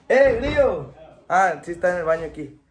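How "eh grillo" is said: high laughing shout; laughing call. Real, not acted. Calling to friend, laughing.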